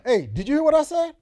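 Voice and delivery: high, loud scolding grandma; grandmother scolding. Retold or imitated. Preacher imitating his grandmother scolding him, high and loud. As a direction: call out like an annoyed grandmother. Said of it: "my grandmother".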